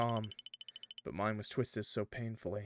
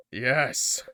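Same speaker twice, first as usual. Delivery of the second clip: loud excited yes; 3 semitones higher, 9 dB louder than usual. Real, not acted. Excited agreeing with demon.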